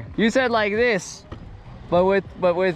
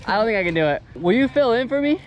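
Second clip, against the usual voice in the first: ordinary delivery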